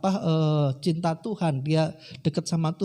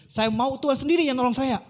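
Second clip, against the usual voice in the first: stubborn refusal; 7 semitones higher than usual. Retold or imitated. Man stubbornly refusing the rescuers.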